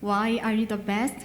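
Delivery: normal talking voice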